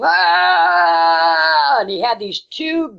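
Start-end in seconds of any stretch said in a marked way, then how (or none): 0.0-1.8 sudden high yell Ah; screaming. Retold or imitated. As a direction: yell it like a charging miner. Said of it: "he was screaming".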